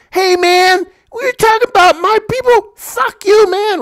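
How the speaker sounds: hammed-up furious gangbanger; high mock outrage; mock angry gangster. Imitating angry gang member.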